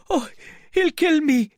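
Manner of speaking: very high, loud panicked scream; terrified shout. Acted. Marvel shouting as the invisible man pounds the door. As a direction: scream it in terror. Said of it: "shouted Mister Marvel".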